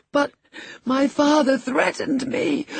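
high, terrified gasp. A narrator's reading. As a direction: gasp out the confession in fear. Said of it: "gasped the boy".

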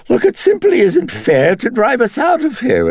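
high, loud wheedling dandy. A narrator's reading. The Dennings personality wheedling at Karras to let it stay, high and loud. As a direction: coax him, all charm and grievance. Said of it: "it wheedled".

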